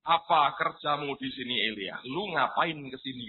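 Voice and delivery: low-pitched questioning voice of authority; deep, lightly acted divine rebuke; God. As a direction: drop the pitch right down to question the prophet. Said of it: "Tuhan berkata".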